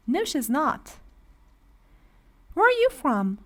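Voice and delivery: ordinary talk, nothing marked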